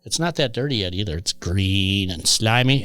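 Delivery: ordinary delivery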